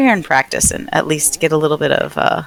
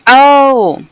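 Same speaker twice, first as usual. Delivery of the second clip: surprised; 8 semitones higher, 8 dB louder than usual. Real, not acted. Sudden recognition of actor.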